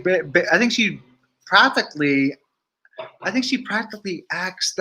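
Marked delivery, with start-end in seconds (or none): none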